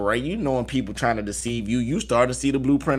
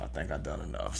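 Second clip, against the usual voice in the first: much softer and lower; near-whisper; 9 semitones lower, 16 dB quieter than usual. Shown as a demonstration. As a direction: let it fade almost to nothing. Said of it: "repeat, much softer".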